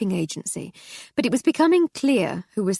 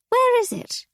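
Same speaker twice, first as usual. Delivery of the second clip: much higher, louder dotty aunt; shrill old dear, confused; old lady voice; 5 dB louder than usual. Acted. Aunt asks where butter is.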